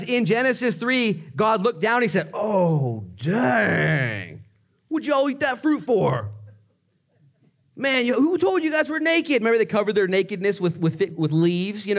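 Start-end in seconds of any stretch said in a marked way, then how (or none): 2.3-6.3 drawn-out dismay climbing high; hammed-up exasperation; comically dismayed God. 7.8-9.4 very high, exasperated voice; hammed-up incredulous complaint; comically scolding God. God scolding Adam and Eve comically.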